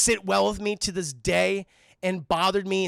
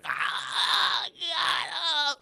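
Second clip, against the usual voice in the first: high strained groaning cry; mock pained cry; 7 semitones higher, much slower than usual. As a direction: cry out from a stab wound, hammed-up. Goblin impaled, acted pain.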